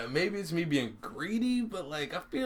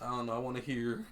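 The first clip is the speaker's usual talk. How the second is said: normal talking voice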